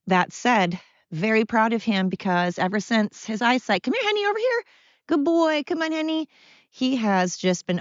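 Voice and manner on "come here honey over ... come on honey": very high sing-song pet talk; coaxing a dog. Real, not acted. Owner guiding her blind dog, high coaxing call. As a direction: coax the dog over in a high voice.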